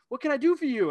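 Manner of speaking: a hint of a theatrical game master; in-character game master. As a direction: greet the player like a fantasy helper. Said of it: "playing, like, really in character".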